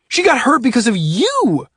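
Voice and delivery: loud, high blame with stress on you; angry accusation. A narrator's reading. Oscar accusing Adrian of getting Dana hurt, angry. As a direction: hammer home whose fault it was. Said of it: "Oscar yelled".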